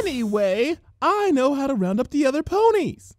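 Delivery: shy pony sounding like herself again; Fluttershy voice. A narrator's reading. Fluttershy offering to round up ponies, high and gentle. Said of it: "sounding much more plausibly like herself".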